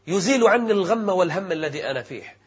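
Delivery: ordinary talk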